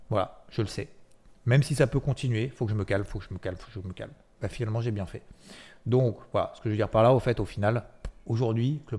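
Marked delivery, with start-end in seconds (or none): 2.5-4.1 each repeat softer until almost gone; calming mantra that dies away; fading self-calm. Retold or imitated. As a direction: talk yourself down, fading out.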